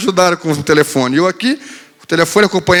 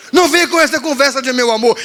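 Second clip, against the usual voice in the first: sulky daughter retort; 6 semitones higher than usual. Retold or imitated. Daughter rejecting father.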